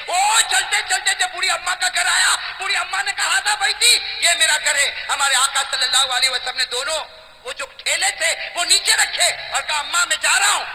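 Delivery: loud, high impassioned sermon voice; rousing storyteller preacher; fiery preaching. Acted. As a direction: preach it out to the crowd, ringing. Preaching the story at full force.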